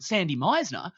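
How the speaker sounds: retold burst of surprised recognition. Retelling his reaction to hearing about the playhouse, surprised recognition.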